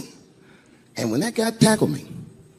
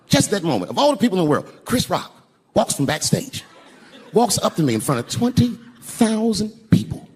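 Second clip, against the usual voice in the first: ordinary talk, nothing marked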